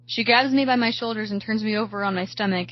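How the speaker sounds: plain reading voice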